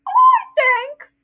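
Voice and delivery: very high, loud cartoon boy; Dudley voice. Shown as a demonstration. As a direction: squeal it like a spoiled kid. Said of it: "this is my Dudley voice".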